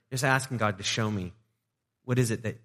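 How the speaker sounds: usual speaking voice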